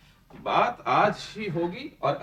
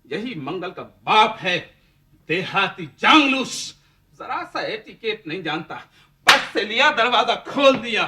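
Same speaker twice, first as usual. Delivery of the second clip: mocking taunt; 2 semitones higher, 8 dB louder than usual. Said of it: "उम्र भर सब ताना देंगे".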